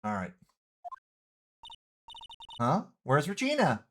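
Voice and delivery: ordinary delivery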